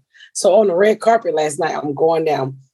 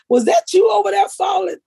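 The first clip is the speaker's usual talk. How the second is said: put-on kids teasing. Kids on show asking.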